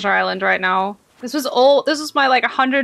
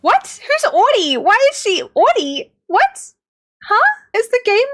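baffled; 9 semitones higher, 3 dB louder than usual. Real, not acted. Baffled by game bug.

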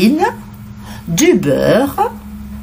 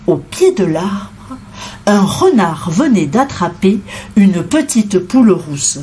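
ordinary delivery

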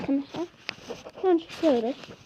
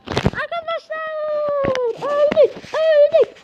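high, loud wail of dismay; dismayed cry; 7 semitones higher, 3 dB louder, much slower than usual. Real, not acted. Boy dies in his game, telling friends in a high dismayed cry. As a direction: cry out that you just died.

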